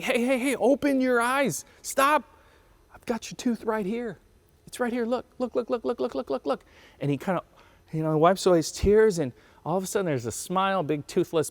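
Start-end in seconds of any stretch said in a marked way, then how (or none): 0.0-2.2 much higher, insistent coaxing; urgent coaxing dad. Acted. Dad gets crying son to open his eyes, urgent coaxing. 2.9-6.6 softer, high-pitched coaxing with rapid repeats; high coaxing dad. Acted. Dad shows crying son the pulled tooth, high coaxing.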